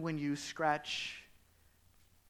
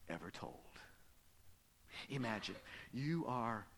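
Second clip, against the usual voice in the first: ordinary talk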